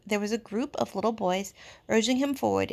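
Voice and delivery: plain reading voice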